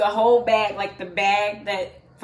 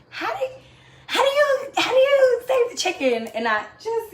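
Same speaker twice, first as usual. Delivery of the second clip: much higher, incredulous whine, half laughing; exasperated; 13 semitones higher than usual. Acted. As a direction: throw up your hands in disbelief. Exasperated rhetorical question.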